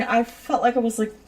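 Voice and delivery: usual speaking voice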